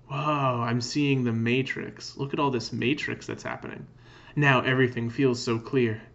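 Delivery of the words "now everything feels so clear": usual speaking voice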